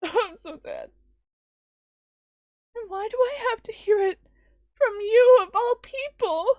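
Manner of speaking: put-on a hurt girl close to crying; tearful girl. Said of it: "to snuff out her sobs".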